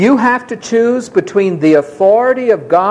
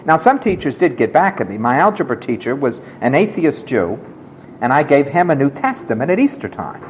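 ordinary delivery

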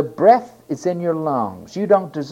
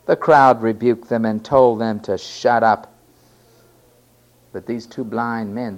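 ordinary talk, nothing marked

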